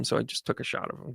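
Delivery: mock-modest whispered aside. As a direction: drop it to a hush. Quoting her modest aside.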